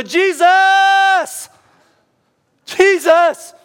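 huge high, drawn-out yell; desperate cry across a crowd; top-of-the-lungs shout. Shown as a demonstration. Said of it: "he shouted to the top of his lungs".

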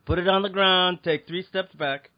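escalating stern commands; calm turning angry. Pretend. Guard escalates at shopper.

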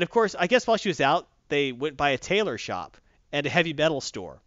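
normal talking voice

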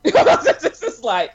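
high laugh through the words. Real, not acted. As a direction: laugh it out slowly. Laughing while talking.

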